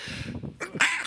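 held-in sneeze; stifled sneeze. Real, not acted. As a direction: swallow the sneeze. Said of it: "that was a suppressed sneeze".

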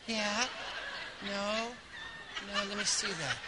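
soft, offhand murmur; absently brushing the kid off; distracted mother. Mother ignores her screaming kid while reading cereal, soft.

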